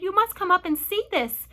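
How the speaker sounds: loud, high, urgent invitation; excited shouting. A narrator's reading. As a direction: shout for a friend to come. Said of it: "they shouted".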